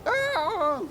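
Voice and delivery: panicked yelling; frantic shouts while fleeing; being chased. Real, not acted.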